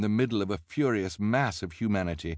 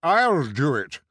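loud, high character shout; forceful put-on voice; insistent cry; 7 semitones higher, 7 dB louder, slower than usual. A narrator's reading. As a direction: shout over the rival to volunteer. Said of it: "the bald man cried".

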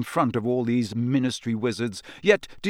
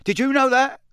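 stern official; 6 semitones higher, 3 dB louder than usual. Acted. Diggory threatening the elf.

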